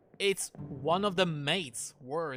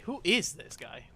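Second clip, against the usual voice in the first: IS punched higher in "Who is this guy"; stress on IS; 4 semitones higher than usual. Real, not acted. Player puzzling over who this guy is, stress on IS. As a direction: lean hard on "is". Repeats question with shifted emphasis.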